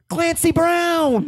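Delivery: high, slow gleeful name drop; excited name drop. Acted. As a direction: draw out the name with glee.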